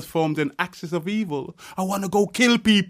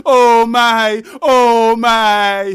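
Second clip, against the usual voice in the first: louder and higher, slow; loud outcry; 6 semitones higher, 9 dB louder, much slower than usual. Acted. Poet crying out over the DJ, much louder. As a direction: cry it out like a wail. Poet's outburst over the radio.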